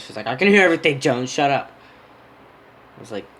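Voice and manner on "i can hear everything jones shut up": loud, high mock yell; retold outburst, raised and louder; imitated annoyed yell. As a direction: holler it like an eavesdropping friend. Friend snaps over speakerphone, retold.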